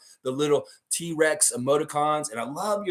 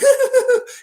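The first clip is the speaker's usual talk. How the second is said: shrill ticklish giggle, much higher and louder; 11 dB louder than usual. Retold or imitated. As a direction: burst into a high tickled giggle. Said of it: "he's, like, laughing".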